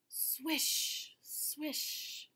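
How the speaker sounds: airy leaf swish in the story; whispered swish. Acted. Narrator voicing leaves falling on the roof, whispered. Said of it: "The leaves fell on the roof".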